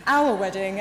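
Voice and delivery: "Our" hit loud and firm as a correction; emphatic OUR. Real, not acted. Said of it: "I always say my wedding".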